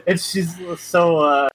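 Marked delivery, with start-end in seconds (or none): none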